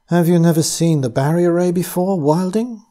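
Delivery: a hint of a sweet, soft-spoken captor; soft blurred accent. A narrator's reading. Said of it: "in that soft, blurred accent, though the voice was low and sweet".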